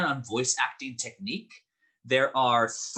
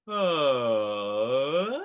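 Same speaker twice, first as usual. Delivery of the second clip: siren glide; 5 dB louder than usual. Shown as a demonstration. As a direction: slide down and up like a siren. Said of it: "make a little siren with our voices".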